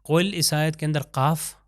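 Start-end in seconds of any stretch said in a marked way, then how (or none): none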